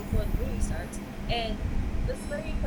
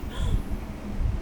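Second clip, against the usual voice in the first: mock gasp; 11 semitones higher than usual. Stranger hears her accent.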